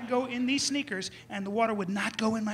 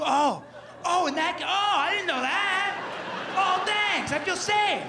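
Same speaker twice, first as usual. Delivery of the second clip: sarcastic gratitude; 8 semitones higher, slower than usual. Acted. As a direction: exclaim with exaggerated, sarcastic relief. Sarcastic thanks for useless shark advice.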